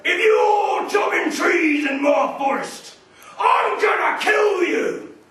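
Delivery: slow, loud, roaring monster voice; a furious giant troll bellowing; troll threat. Acted. Troll threatens second son.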